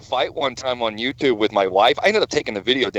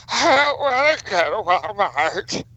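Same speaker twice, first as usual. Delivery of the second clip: over-the-top wailing caricature; high, drawn-out mock whine; Keish; 6 semitones higher, slower than usual. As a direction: ham it up, stretching every word high. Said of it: "do an over-the-top impression of Keish".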